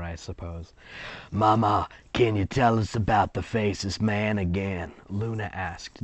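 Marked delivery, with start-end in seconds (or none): 1.3-4.9 a lightly voiced little girl; Luna's voice. Luna asking grandma for a story, lightly voiced child. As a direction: ask it like a small curious child. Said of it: "That's Luna in my head".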